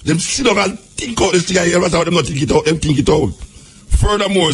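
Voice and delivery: ordinary delivery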